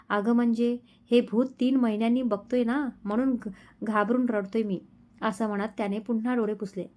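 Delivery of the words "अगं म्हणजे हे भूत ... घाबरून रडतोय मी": ordinary delivery, nothing marked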